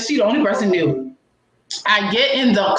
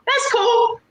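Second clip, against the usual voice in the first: much higher, sarcastic little yelp; 14 semitones higher than usual. Retold or imitated. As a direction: toss it off with fake cool. Sarcastic retort to friend.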